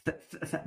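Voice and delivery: ث- as a voiceless th, tongue out; th sound drill. Shown as a demonstration. Teacher drilling th with tongue out. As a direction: hiss ث- with tongue between teeth. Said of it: "تطلع لسانك في التي اتش".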